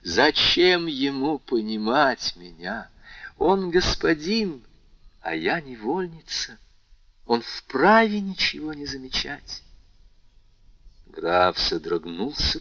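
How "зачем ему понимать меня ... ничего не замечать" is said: higher, pained bitter lament; heartrending. Acted. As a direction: pour out the hurt of a slave girl. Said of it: "душераздирающим голосом". (душераздирающе, с болью)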